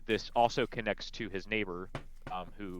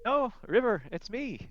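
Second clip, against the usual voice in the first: gentle correction; 7 dB louder, slower than usual. Acted. Glamdry gently telling River who he is. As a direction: let her down gently. Glamdry answers the old woman.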